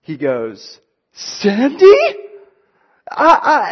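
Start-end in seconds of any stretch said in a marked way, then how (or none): 1.1-1.9 high, loud astonished call of her name; over-the-top excited. Retold or imitated. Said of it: "over-the-top excited response". 3.1-3.7 high, loud flustered stammering sounds; overwhelmed boy tongue-tied; excited stammer. Retold or imitated. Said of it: "he just starts stuttering".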